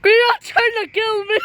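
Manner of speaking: panicked high shouting; being chased. Real, not acted. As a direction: scream for help in panic. Panicked while attacked in game.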